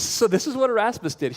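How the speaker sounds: normal talking voice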